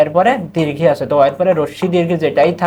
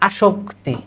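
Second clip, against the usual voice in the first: ordinary talk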